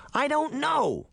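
high, irritated snap; snapping. A narrator's reading. Sabrina arguing with Puck, snapping back. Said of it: "Sabrina snapped".

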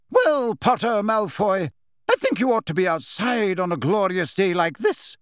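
high, emphatic schoolmistress briskness; brisk. A narrator's reading. Professor sending two pupils outside, briskly. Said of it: "Professor McGonagall continued briskly".

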